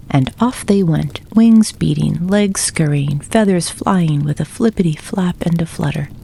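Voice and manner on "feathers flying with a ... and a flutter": ordinary delivery, nothing marked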